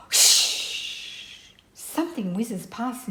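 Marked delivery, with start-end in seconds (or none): 0.1-1.5 loud rushing shh, trailing away; long unvoiced whoosh. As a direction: make a whizzing-past noise with shh. Something whizzes past in the story.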